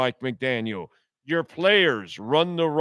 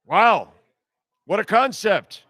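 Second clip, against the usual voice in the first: sarcastic amazement; 6 semitones higher, 5 dB louder than usual. Real, not acted. Mocking the coach's choice of word.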